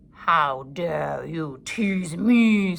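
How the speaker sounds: very slow, loud snarl; snarling wolf. A narrator's reading. Wolf snapping at the bird, slow loud snarl. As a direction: snarl it with menace. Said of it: "snarled the wolf".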